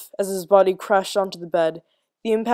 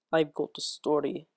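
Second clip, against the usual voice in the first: low, soft, hushed voice; a hidden voice offering a story, hushed and low; whispered; 4 semitones lower, 8 dB quieter than usual. A narrator's reading. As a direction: breathe it from the shadows. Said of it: "it whispered".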